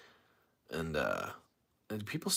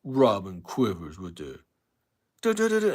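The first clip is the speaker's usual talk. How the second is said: mock the caller's rant, climbing; Hate Man impression; 7 dB louder than usual. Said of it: "he would get in there and he'd".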